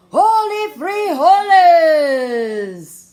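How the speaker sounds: loud, slow, drawn-out exclamation; loud cry. Acted. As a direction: cry it out as he raises his sword. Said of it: "cried Skipito".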